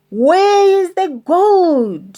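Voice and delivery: slow, loud, high demanding shout; angry shout. Acted. Pirate chief demanding gold from troll, slow angry shout. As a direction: bellow it at the troll. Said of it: "shouted Handkerchief".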